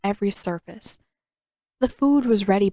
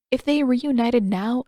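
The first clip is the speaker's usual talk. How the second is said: ordinary delivery, nothing marked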